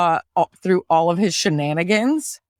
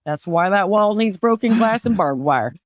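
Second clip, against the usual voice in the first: put-on Southern accent. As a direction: play a needling Southerner with a thick accent. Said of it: "can you do an Appalachian accent".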